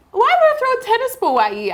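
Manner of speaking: 1 retold disbelief. Retold indignant reply.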